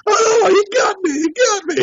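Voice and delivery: very high, crying yelp; mock wailing. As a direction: wail like a hammed-up casualty. Acts out writhing on the ground.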